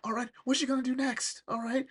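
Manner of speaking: put-on high, gushing boys' excitement; acted thrilled kids, pitched well up; super excited. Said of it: "the boys are super excited".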